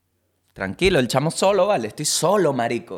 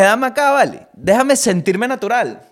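higher, louder, eager demand; snatch the bag with greedy glee; buying fancy chips with mock excitement; eager grab; 8 semitones higher, 7 dB louder than usual. Eager self-quote grabbing chips.

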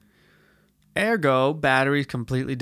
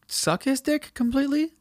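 mock grovelling; 12 semitones higher than usual. Mocking sucking up.

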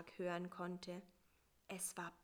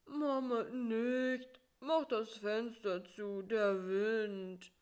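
mild sleep mumble; 3 dB louder, slower than usual. Acted. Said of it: "nuschelte im Traum".

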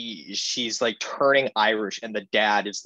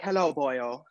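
put-on Irish accent; 4 semitones higher, 3 dB quieter, slower than usual. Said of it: "Can you do it?".

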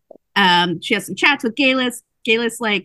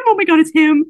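higher, louder excited gasp; thrilled she spots him; excited squeal; 9 semitones higher, 8 dB louder than usual. Retold or imitated. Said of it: "she's like".

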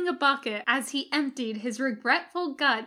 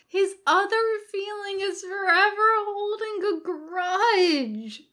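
loud high complaint; crying out; 10 semitones higher, 5 dB louder than usual. A narrator's reading. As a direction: cry it out in protest. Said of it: "Jim cries".

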